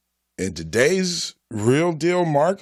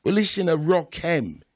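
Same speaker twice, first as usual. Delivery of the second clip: London street accent, put on; mock British accent; 7 semitones higher than usual. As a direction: talk like a British road man. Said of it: "I don't know if I can stop talking like this".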